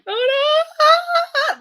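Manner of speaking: high, loud cry of pain; girl on the hallway floor screaming in pain. Retold or imitated. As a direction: scream as if the boob broke.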